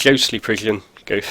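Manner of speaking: normal talking voice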